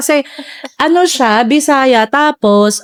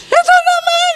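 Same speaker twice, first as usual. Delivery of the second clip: high, amused mock protest; laughing mother. Mother laughing at her daughter's parody, amused and high. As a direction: protest through a laugh like a teased mom. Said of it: "natatawa siya".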